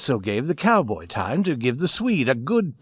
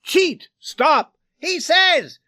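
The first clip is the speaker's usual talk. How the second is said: high, loud shouted fragments; sharp yells cutting through a brawl; ringing out sharply; 5 dB louder, much slower than usual. A narrator's reading. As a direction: shout each scrap separately. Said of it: "cut the screaming and rang out sharply".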